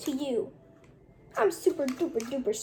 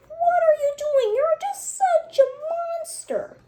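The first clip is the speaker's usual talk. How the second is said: high, loud shocked cry; high shocked accusing cry; shocked outcry; 12 semitones higher, 3 dB louder than usual. Acted. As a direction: recoil in horror at a monster. Character shocked by cruelty.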